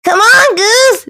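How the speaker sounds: high call across the hill; yelling. Acted. Duck calling Goose to follow, yelled high. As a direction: call out to him from afar. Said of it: "Duck yelled".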